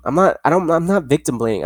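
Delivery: usual speaking voice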